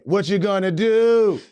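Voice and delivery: drawn-out, high hollered reply; long, loud answering yell; yelling back. Retold or imitated. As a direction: sing it back loud and slow.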